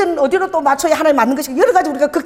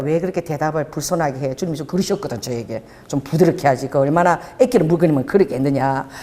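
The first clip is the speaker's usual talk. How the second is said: lower, softer voice of the Lord; softly lowered divine rebuke; the Lord's gentle rebuke; 10 semitones lower, 3 dB quieter than usual. Retold or imitated. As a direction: speak as God gently correcting her. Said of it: "주님이 깨닫게 하신 거야".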